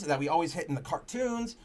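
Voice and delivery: usual speaking voice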